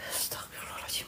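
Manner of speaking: breathless frightened whisper; whispered prayer. Real, not acted. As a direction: whisper it in fear. (bisikan ketakutan)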